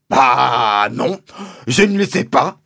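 loud, dry retort; Mister Hazel, curt. A narrator's reading. Landowner rebuffing the father's question, curt and loud. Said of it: "a répliqué sèchement mister Hazel".

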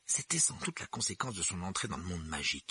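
ordinary delivery

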